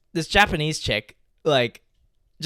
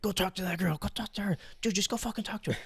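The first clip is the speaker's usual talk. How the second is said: pushy friend; 5 semitones higher, 9 dB quieter, faster than usual. Retold or imitated. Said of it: "They start fucking pushing me".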